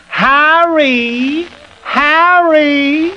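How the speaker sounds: high, drawn-out call, rising. Shown as a demonstration. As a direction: pull the word from mouth front to back of throat. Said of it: "front of his mouth to the back of his throat".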